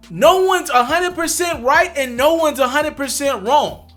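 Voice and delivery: ordinary delivery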